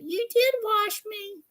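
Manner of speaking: high, slow puppet protest; Minnie the puppet. Acted. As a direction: answer back in the squeaky puppet voice. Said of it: "she's my puppet".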